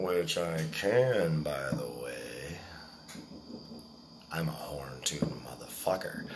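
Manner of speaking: slow, low, hushed boast; drawn-out, playful murmur to the listener; whispered aside. Pretend. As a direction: drop into a mock-secret whisper. Mock-secret boast about himself.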